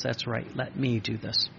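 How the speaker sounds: quiet voice of God. Retold or imitated. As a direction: speak as God, hushed and calm. And God goes.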